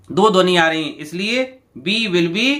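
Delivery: ordinary talk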